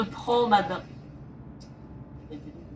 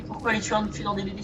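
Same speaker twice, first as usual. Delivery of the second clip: much softer, confiding retake of the question; hushed question; 10 dB quieter than usual. Acted. Streamer asks viewers again in a soft hushed voice. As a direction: ask it again softly, as if sharing a secret. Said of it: "Alors les amis".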